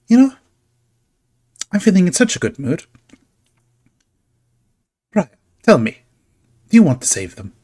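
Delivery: high, loud and teasing; playful showman. Acted. Carnival figure tempting Brian with a chance, high teasing voice. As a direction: coax the player into a bargain.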